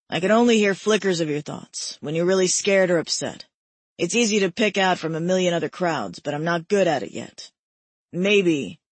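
low, earnest man's voice. A narrator's reading. Male character voiced at length.

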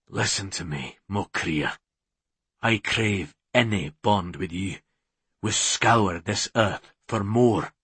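low, hushed man's voice; ardent, pleading hero. A narrator's reading. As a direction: vow devotion in a low, soft voice. Hero declares his devotion.

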